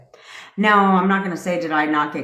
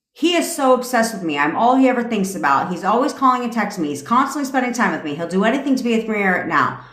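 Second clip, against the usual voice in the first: higher, bright-sounding sing-song chant of affirmations; affirmation recital; 4 semitones higher than usual. Shown as a demonstration. Coach demonstrating affirmations to creators, higher recital voice. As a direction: recite it like a mantra, over and over. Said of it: "So you'll say".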